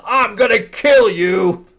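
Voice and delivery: hammed-up superhero yell; mock hero threat. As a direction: ham up the hero's threat.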